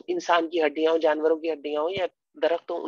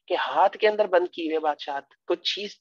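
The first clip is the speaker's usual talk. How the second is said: ordinary talk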